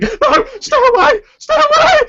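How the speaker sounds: very high, very loud panicked screaming; old woman screaming as she runs away; terrified shriek. Acted. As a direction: shriek it as you flee in terror. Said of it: "this woman shrieking".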